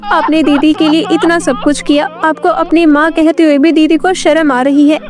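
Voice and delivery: high-pitched crying complaint; tearful. Acted. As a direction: plead with mother as a crying younger girl. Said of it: "तभी राधा रोते हुए बोली". (रोते हुए शिकायत)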